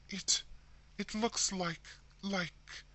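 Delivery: stammering in horror at the shadow; horrified stammer. A narrator's reading. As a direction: stumble over the words, frozen with dread. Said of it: "stammered Missus Brigham in a tone of intense horror".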